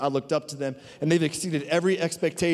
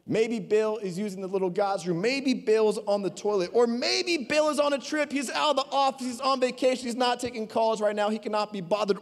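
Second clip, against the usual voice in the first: higher, playful sarcastic jabs; mocking retold; 6 semitones higher than usual. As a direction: rib Baal like a no-show coworker. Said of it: "Elijah began mocking them".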